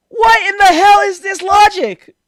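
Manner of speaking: loud, high-pitched indignant outburst; real fed-up outrage; exasperated anger. Real, not acted. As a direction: blow up at the absurd logic. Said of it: "I'm getting so mad".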